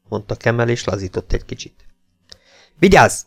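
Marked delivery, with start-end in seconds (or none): 2.8-3.2 loud, high bark of an order; roared command. A narrator's reading. Jakes orders Kemmel back to attention, roaring. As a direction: roar it like a drill sergeant. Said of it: "üvöltötte Jakes".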